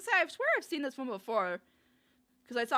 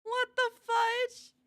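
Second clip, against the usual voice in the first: much higher, slow, stretched out; stunned drawn-out gasp; 12 semitones higher, 4 dB louder, much slower than usual. Real, not acted. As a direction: let the disbelief hang on every word. Reacting to a weird clip.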